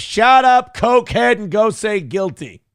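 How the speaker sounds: fed-up loud retort; exasperated shout. Real, not acted. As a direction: yell it at him in disbelief. Mocking rant at lawyer.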